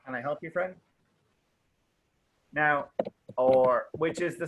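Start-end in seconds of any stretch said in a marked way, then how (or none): 0.0-0.7 softer, quick casual question with a rise; casual offer of help. Shown as a demonstration. Teacher plays a stranger approached in a bar, casual offer. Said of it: "I might say something like this".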